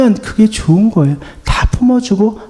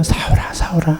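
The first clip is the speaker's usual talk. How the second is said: soft tender call; 4 semitones lower, 6 dB quieter than usual. Pretend. The Lord calling Saul tenderly.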